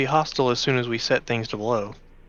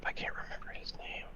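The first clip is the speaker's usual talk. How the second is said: whisper; 17 dB quieter than usual. Real, not acted. Whispered aside to table.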